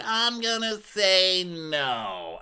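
high, slow mocking voice of the guy; mock guy quote. Recapping a reality show guy's refusal, mocking high voice.

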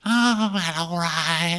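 high, sighing falsetto; exaggerated let-down reply; mock disappointment. Crewmate told help is not coming, high mock disappointment.

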